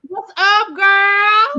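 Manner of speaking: high, loud, delighted hello to a friend; excited loud greeting. Real, not acted. Host greets her cohost joining the stream, loud and delighted. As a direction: call it out like you're thrilled she's here.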